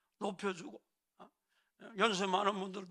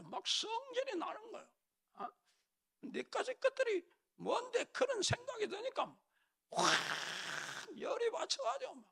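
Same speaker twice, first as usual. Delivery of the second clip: mock rising temper; 14 semitones higher, 6 dB quieter than usual. Preacher imitating the proud king losing his temper, strained high seething. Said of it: "막 화를 내는 거예요".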